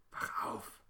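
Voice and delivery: urgent whispered wake-up; scared girl hissing at her brother. A narrator's reading. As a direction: hiss it without voice. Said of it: "zischt sie".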